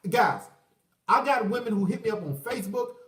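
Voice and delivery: usual speaking voice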